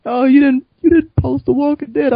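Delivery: high, slow mocking whine; imitating Wayne whining about a post. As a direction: mimic a friend's whiny accusation.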